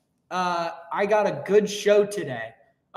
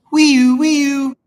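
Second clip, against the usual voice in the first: high wailing police siren; siren noise; 9 semitones higher, 10 dB louder than usual. Pretend. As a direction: wail like a cop car.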